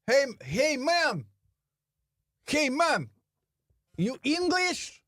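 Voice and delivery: mock shout, pitched high, calling across the street; retold street shout. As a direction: call out high like a hustler hailing a stranger. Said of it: "And he shouts out".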